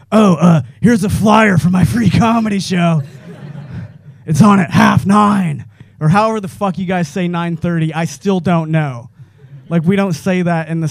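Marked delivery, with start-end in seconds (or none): 0.1-5.6 louder, slow shouted plug; loud flyer pitch. Pretend. Batman plugging the comic's show, loud shouted pitch. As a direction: bellow the show plug, slowing on half nine. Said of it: "he could just be like".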